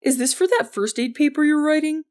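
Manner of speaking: high, odd cover-up question; strange voice. A narrator's reading. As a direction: pitch it up, pretending not to understand. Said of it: "Jordan said in a strange voice".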